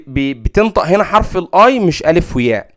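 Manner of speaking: usual speaking voice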